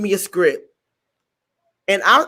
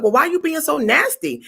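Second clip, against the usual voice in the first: high indignant complaint; mock offended pushback; 7 semitones higher than usual. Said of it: "And I was like".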